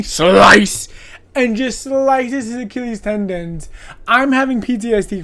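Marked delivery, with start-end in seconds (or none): 0.0-0.9 loud punchy slice; sound effect shout. Retold or imitated. Killer cutting tendons, loud slice sound effect. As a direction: bark it like a blade hit.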